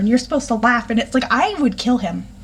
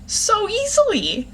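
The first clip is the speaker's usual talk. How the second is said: exasperated sass; 3 semitones higher than usual. Retold or imitated. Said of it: "I'm reading that line going".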